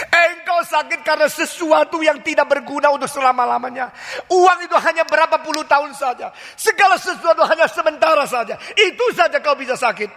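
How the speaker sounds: full-force revival preaching; forceful preaching. Real, not acted. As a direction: preach hard, rebuking the congregation.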